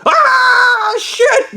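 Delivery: very high, long scream breaking into a curse; retold terrified scream. Reliving the creature's approach, terrified scream. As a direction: scream it out high in acted terror. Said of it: "I was fucking terrified".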